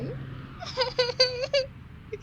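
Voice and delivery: real high laugh; high-pitched laugh. Real, not acted. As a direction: burst of high giggling. Laughing at the crying scene.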